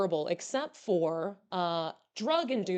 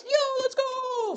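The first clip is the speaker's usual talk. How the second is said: high, drawn-out hype yell; long celebratory whoop in words; excited cheer; 4 dB louder, much slower than usual. Real, not acted. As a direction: cheer them on, stretching the last word. Cheering a viewer's good news.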